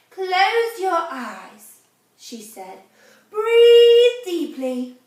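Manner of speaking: very slow, drawn-out calming words; slow soothing guidance. Acted. Therapist guides Camilla to relax, very slowly. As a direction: stretch each word like a relaxation coach.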